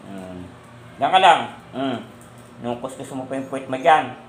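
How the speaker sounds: ordinary delivery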